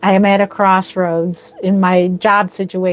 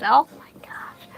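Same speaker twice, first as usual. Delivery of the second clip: breathless whisper; a touched whisper to herself; whispered gasp; 3 semitones higher, 7 dB quieter than usual. Real, not acted. Reacting to compliment.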